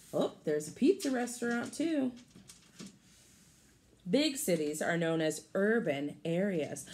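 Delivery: plain reading voice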